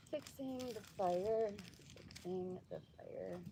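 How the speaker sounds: slow, soft muttering to yourself; half-voiced, slow self-talk. Real, not acted. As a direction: narrate your chore under your breath. Muttering to self while busy.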